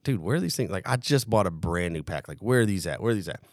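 low, softly spoken grumble; retold puzzled searching. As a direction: re-enact asking around, low and soft.

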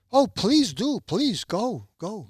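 high-pitched, eager urging; excited imitation of a willing owner; eager plea. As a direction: imitate someone urgently waving him on. Owner eagerly grants his request.

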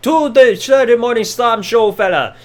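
a lightly put-on Irish accent, hamming the promo; Irish lilt parody, drawing out fella; mock Sheamus Irish brogue. Recapping Sheamus's promo to viewers, mock Irish accent. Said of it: "he cuts a pre-match promo saying".

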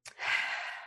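soft, long releasing sigh; deep sigh. Shown as a demonstration. Showing how to release tension, deep relaxing sigh. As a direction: let the breath go slowly. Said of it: "take some deep breaths".

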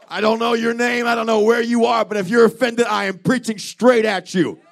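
forceful, emphatic sermon delivery; fired-up revival preacher; full-force preaching. Real, not acted. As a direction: preach straight at the listener, pressing each phrase. Preaches directly at the offended.

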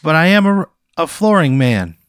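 high, loud, nervous local ad voice; nervous TV pitchman. Acted. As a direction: play an awkward local TV salesman. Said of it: "He says".

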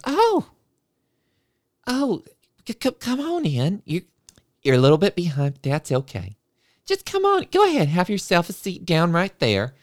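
high, sing-song music teacher cooing into a mic; sugary teacher voice. Retold or imitated. Music teacher cooing at a late class, high and sing-song. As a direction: gush like an overeager music teacher.

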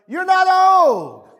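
high, long-held preacher's shout; shouted rebuke. Real, not acted. Preacher answering people who complain at fifty, shouted rebuke. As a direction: holler it down with full conviction.

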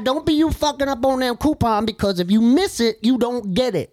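put-on high, emphatic older woman's voice; thrift store lady. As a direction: scold a customer in an older lady's high voice.

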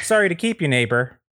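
high, folksy neighbor farewell; Andy Daly. Acted. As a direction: let them go with chirpy neighborliness. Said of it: "is played by Andy Daly".

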